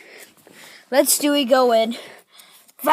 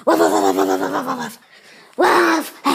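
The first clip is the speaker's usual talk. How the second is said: fast run of dog barks; rapid barking; 4 semitones lower than usual. Acted. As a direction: bark in a quick attacking volley.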